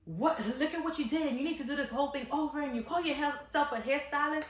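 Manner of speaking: half-hearted imitation of the cursing mom; mild mock rant. Stylist retelling the mother's rant, mild mock outrage. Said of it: "she starts to curse and she's like".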